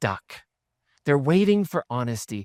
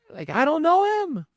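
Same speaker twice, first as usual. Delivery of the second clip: laughing mock denial; 11 semitones higher than usual. Peter denying Jesus, retold.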